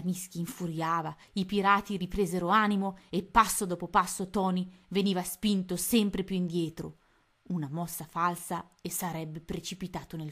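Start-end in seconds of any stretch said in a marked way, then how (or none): none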